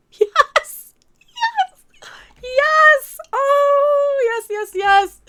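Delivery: excited cheering. Real, not acted. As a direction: cheer like your team just scored. Cheering at the clip.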